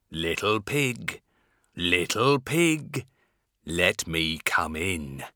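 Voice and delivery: lightly growled wolf voice, slow; low slow growling wolf; werewolf. Acted. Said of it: "growled the wolf".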